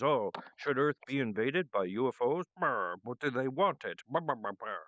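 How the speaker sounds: lightly voiced council of gods, muttering; a hint of grumbling gods, muttering "brr"; murmuring gods. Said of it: "gods murmuring and talking about issues".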